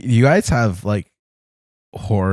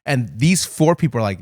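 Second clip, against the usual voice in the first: usual speaking voice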